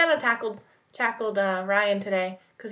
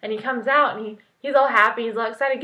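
ordinary talk

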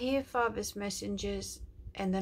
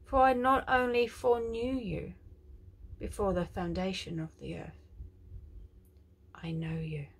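tender prophetic declaration; 5 dB louder than usual. Acted. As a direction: speak as God comforting his child. Prophetic quote.

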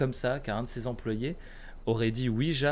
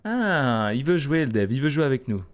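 shaken but hyped, voice raised; panicking gamer; 3 semitones higher, 7 dB louder than usual. Real, not acted. Reacting to scare in game.

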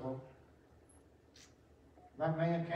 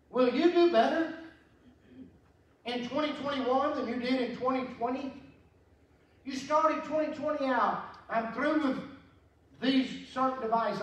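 full force preaching; 9 semitones higher, 5 dB louder than usual. Acted. Preacher telling the congregation the Bible story, in full cry. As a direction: lean into the gospel story.

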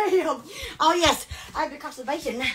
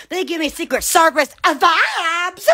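ordinary talk

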